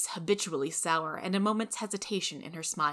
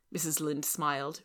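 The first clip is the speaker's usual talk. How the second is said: plain reading voice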